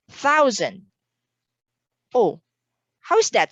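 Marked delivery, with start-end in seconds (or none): 0.1-0.9 "Thousand" with a clear, higher-pitched Z syllable; S sounded as Z. Shown as a demonstration. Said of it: "would have the S sound like Z".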